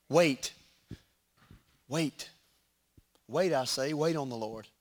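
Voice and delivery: each Wait said alone, soft and spaced; solemn repeated wait. Acted. Preacher urging the church to wait on the Lord, softly. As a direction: let each Wait hang in silence.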